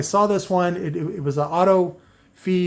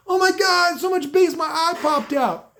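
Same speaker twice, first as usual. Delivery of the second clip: high, shouted, over-the-top amazement; mock wide-eyed hype shout; mock reaction-video host; 12 semitones higher than usual. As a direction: ham up a fake jaw-dropped reaction. Mocking reaction video excitement.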